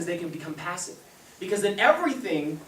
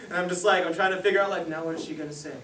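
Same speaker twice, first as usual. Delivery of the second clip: ordinary talk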